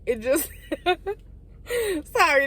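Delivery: laughing through the words; laughing apology. Real, not acted. Storyteller derailed by a remark about their sister, laughing. Breaks up laughing at own aside.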